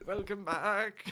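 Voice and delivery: laughing imitation of a wobbly old host; shaky old presenter. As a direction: draw out a shaky old greeting. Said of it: "You got the shakiness in".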